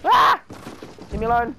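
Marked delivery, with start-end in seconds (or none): 0.1-0.4 loud, high frustrated yell. Real, not acted. Gamer under attack, high frustrated yell. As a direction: let out a sharp frustrated cry. Frustrated cry mid-game.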